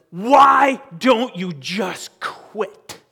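loud, drawn-out bellow; drill sergeant shout. Acted. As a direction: shout each word slowly at a beaten recruit. Acted drill sergeant in film scene.

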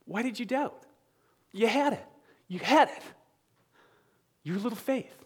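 tender, hushed near-miss sympathy; gentle, restoring reading. Shown as a demonstration. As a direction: gently encourage someone who nearly made it. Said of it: "I read it".

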